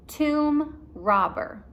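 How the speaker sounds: slow, spelled-out words; dictating slowly. Shown as a demonstration. Teacher dictating to class, slow and clear. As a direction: dictate for writing. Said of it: "Can you write that".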